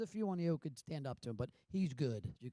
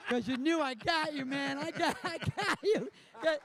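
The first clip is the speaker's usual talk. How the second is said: laughing through it; 9 semitones higher than usual. Real, not acted. Laughing while retelling the scene.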